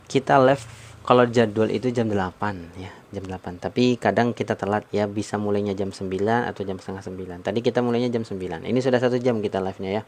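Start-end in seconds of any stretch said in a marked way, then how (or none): none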